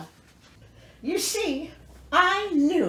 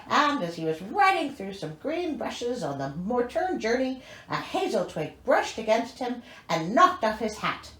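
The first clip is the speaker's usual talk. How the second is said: ordinary talk, nothing marked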